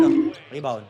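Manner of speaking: usual speaking voice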